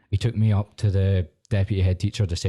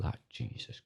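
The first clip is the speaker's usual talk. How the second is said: breathless unvoiced mutter, much softer; whispered mutter; 4 semitones lower, 12 dB quieter, slower than usual. Pupil muttering at screaming teacher, whispered slowly. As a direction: mutter it under your breath, slow. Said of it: "I was like".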